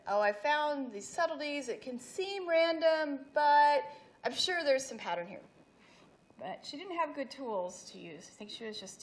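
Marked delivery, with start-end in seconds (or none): 0.1-5.4 higher, louder mock-earnest voice; lightly hammed-up quote, raised in pitch and volume; imitating hopeful author. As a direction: act out the author insisting, with emphatic pitch lifts. Voicing author's hopeful conclusion.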